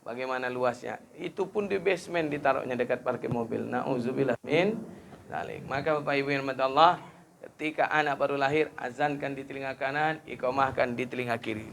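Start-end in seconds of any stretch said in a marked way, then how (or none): none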